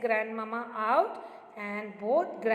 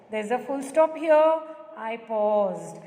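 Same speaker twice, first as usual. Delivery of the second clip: ordinary talk, nothing marked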